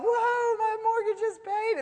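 high-pitched whoop of joy; jubilant praise. Acted. Lady finds the money in her mailbox, jubilant. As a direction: shout it out in celebration. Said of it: "And she's praising God".

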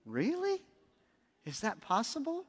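high, hushed, wondering to herself; a soft, high gasp of disbelief; stunned disbelief. Retold or imitated. Woman walking away from the prophet, murmuring in hushed, high disbelief. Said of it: "she walks away thinking".

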